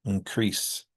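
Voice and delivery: increase as in-CREASE, stress on the second syllable; reduced first vowel. Shown as a demonstration. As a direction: weaken the start of increase, lean on CREASE. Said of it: "they get reduced to uh or I".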